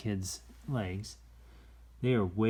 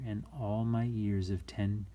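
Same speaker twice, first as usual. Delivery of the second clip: plain reading voice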